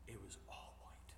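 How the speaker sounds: breathy whisper, almost no voice; hushed reveal. Acted. Pastor whispers the story's ending to the congregation, breathy whisper. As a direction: let the ending land in a whisper.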